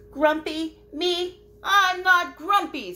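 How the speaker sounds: very high, loud offended protest; indignant denial. Acted. Jim denies being grumpy to Lemur. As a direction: shout it out in offended disbelief. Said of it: "said Jim".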